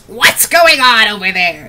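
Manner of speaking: loud, cranky crone croak; indignant old crone calling out; croaking old woman. Acted. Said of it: "she croaks".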